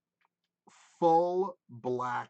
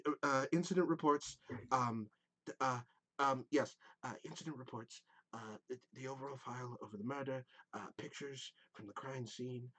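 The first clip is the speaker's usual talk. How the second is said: soft, stammering nervous clerk voice; fumbling through papers, softer and faster by the end; a lightly voiced anxious young satyr; flustered satyr clerk; 9 dB quieter than usual. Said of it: "he goes".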